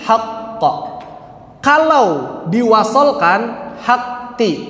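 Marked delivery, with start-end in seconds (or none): 0.0-0.6 "haqq" with the q stopped and held; whisper-short "haqq", the doubled q pressed and held. Shown as a demonstration. Teacher demonstrating the stop on "haqq" for students. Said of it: "Kalau ada tasydid".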